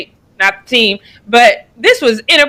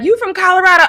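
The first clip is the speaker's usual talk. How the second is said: higher-pitched mock challenge; imitating others; 8 semitones higher, faster than usual. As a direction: retell it as a teasing question from other people. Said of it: "people be like".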